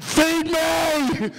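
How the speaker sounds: high, drawn-out dog voice; demanding dog. Acted. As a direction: bellow it like a hungry dog.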